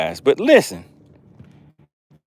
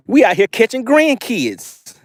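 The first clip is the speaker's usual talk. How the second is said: amused; 9 semitones higher, slower than usual. Real, not acted. Joking about tiny fish.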